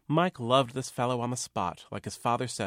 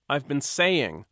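irritable; 5 semitones higher, 6 dB louder, slower than usual. Acted. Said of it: "the postman said irritably".

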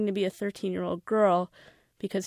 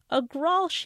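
put-on English accent; 8 semitones higher than usual. A narrator's reading. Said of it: "patient each time repeating".

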